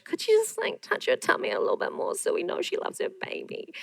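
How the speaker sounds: high, breathy mock-sweet whisper; mocking sweet notes. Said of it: "I had people want her to be more feminine".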